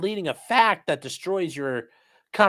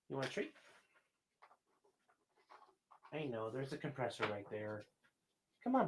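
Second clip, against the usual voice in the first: much softer coaxing voice for a pet; talking to a dog; 10 dB quieter, faster than usual. Real, not acted. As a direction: gently coax a skittish dog closer.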